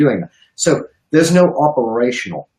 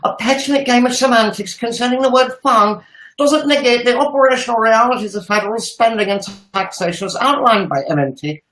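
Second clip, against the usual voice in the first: higher, laughing pleading urchin voice; a laughing, higher little-boy voice asked for by the host; Tiny Tim voice; 7 semitones higher than usual. Acted. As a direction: play a pitiful little orphan boy reading it out. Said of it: "Do it in your Tiny Tim voice".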